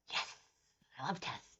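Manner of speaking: very soft, secret little cheer; hushed aside. Retold or imitated. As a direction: say it under your breath, as if to yourself. Acting out own eager secret reaction.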